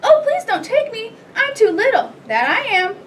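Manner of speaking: very high little goat voice, begging; small pleading goat. A narrator's reading. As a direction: plead in a tiny high-pitched voice. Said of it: "his very small voice".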